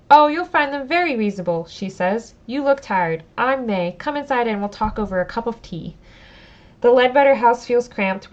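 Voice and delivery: reading the story text aloud, steady and clear; storyteller read; story narration. Acted. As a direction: narrate the scene for the audience. Reads the game text aloud.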